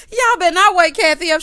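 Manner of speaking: loud, high teasing scold; playful loud scolding. Acted. Host teasing the housewives for staying up all night, loud playful scolding. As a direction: call them out loud and high, half laughing.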